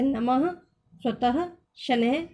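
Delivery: नमः, स्वतः, शनैः each closing on an h; visarga like half ह. Shown as a demonstration. Teacher repeating visarga examples, final ह sounded. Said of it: "ह हल की तरह से बोलते हैं".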